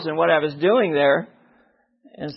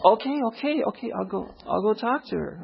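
starts high, drops softer; acted reluctant giving in; 4 semitones higher, 5 dB quieter than usual. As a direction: give in grudgingly to the nagging. Gives in during acted back-and-forth.

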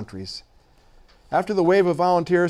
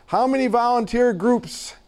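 mild mock shout; 7 semitones higher than usual. A narrator's reading. Reporter asking the director over noisy children, mildly mimicked shout. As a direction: call it as if over a din. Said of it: "I shouted over the din".

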